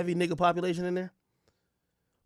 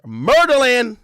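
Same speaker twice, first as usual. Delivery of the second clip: higher, louder hype yell; hype shout; 10 semitones higher, 14 dB louder, much slower than usual. Real, not acted. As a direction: shout the nickname up high. Hyping Baltimore nickname.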